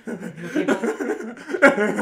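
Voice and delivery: high, loud, very slow laugh; laughing drawl. Real, not acted. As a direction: stretch it laughing. Drawn-out laughing word.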